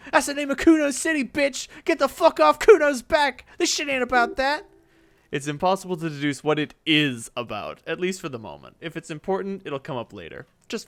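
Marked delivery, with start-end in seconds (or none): 0.1-4.6 high, loud snarling brat; a furious little punk; bratty kid Kuno. Acted. Kuno tells the detective off, high and loud.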